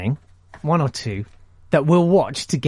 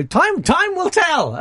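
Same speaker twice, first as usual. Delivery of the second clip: high dramatic; slower than usual. Acted. Mock dramatic pronouncement.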